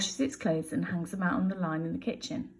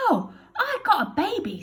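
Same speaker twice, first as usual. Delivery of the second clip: Bill voice; 11 semitones higher, 5 dB louder than usual. Acted. Bill tells Betty.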